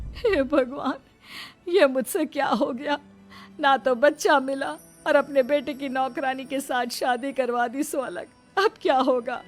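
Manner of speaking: acted wail of regret and dismay; distraught mother-in-law. Mother realising she was fooled, distraught.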